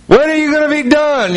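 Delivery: high-pitched, exasperated mock complaint; impatient call. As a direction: call out like a fed-up husband waiting, hammed-up. Acted impatient wait outside dressing room.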